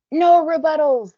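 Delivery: high, loud, drawn-out scream; screamed in unison. A narrator's reading. Girls shutting down the newcomer, screamed. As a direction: shout it down the room, high and slow. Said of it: "the girls scream in unison".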